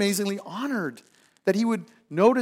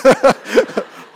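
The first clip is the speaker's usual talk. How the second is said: bright laugh bursting out; delighted laugh; 8 semitones higher, 12 dB louder than usual. Real, not acted. Preacher laughing at God visiting his cubicle, delighted laugh. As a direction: laugh with real glee at the idea.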